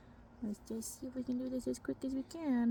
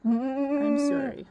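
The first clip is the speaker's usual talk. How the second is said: soothing; 4 semitones higher, 7 dB louder, much slower than usual. Real, not acted. Apologizing to goat during blood draw.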